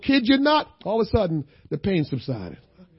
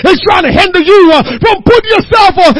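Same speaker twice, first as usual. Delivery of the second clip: crying preacher's shout; 8 dB louder than usual. Acted. Preacher commanding the church to destroy evil thoughts, crying out. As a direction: hammer each line with a tearful shout. Building back to full force.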